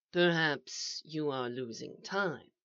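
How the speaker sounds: hushed, slow suggestion; soft and calm. A narrator's reading. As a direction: suggest it quietly, unhurried. Calm mysterious voice answers.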